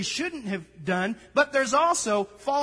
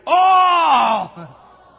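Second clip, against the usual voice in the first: very loud, higher, drawn-out "All"; annoying shout; 5 semitones higher, 13 dB louder than usual. Shown as a demonstration. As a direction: blast "All" as annoyingly as you can. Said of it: "say it annoying like I do".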